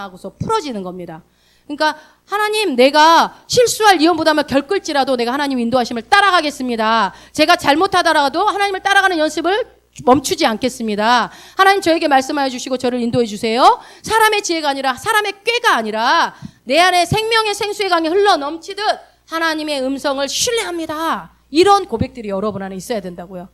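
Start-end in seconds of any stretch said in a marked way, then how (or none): 2.3-13.8 loud, resolute prayer of surrender; fervent declared prayer. Acted. Models a bold prayer aloud. 14.0-21.3 high, loud declaration of trust; impassioned preacher's declaration; fervent confession of faith. Acted.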